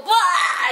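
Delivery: high mock wail of a falling goblin; goblin cry. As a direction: yelp it like a goblin toppling over. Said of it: "suddenly they would go".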